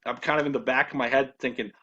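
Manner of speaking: ordinary talk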